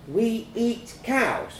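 put-on high, slow child's question; stunned child. A narrator's reading. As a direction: ask it slowly in childish disbelief.

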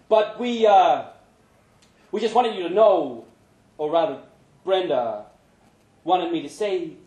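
lightly voiced awkward friend; halting Pancho. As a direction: struggle to get it out. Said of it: "finding it hard to pick the right words".

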